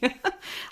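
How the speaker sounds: quick amused laugh, pitch up; a little giggle at her own joke; short laugh. Real, not acted. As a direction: speaker laughs at her own question about waking or sleeping.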